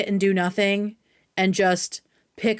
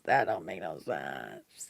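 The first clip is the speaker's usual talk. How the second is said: much lower, softer and slower; mock deep voice; 12 dB quieter, slower than usual. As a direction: drag it out in a low silly voice. Repeats phrase in put-on voice.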